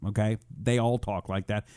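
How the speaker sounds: ordinary talk, nothing marked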